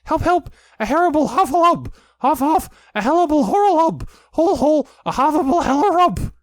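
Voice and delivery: very high, loud panicked squealing; frantic tongue-tangled alarm; Piglet crying out. A narrator's reading. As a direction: shriek it, words tumbling into muddle. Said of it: "still crying out".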